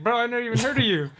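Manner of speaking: retold incredulous retort. Player retelling his comeback to a rival, incredulous.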